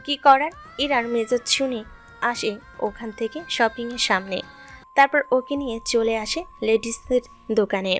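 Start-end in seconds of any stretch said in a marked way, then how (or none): none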